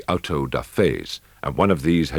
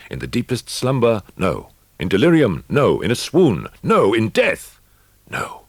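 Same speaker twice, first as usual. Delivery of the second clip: higher and louder with each no; building to a cry; 8 semitones higher, 8 dB louder than usual. A narrator's reading. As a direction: climb from firm to near shouting. Mounting denial of lost consciousness.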